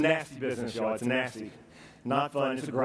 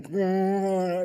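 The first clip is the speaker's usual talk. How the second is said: higher, drawn-out mock growl; wordless resisting noise, acted out; imitated growl; 5 semitones higher than usual. Stricken man fighting the gel in his mouth, growling. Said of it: "He's going".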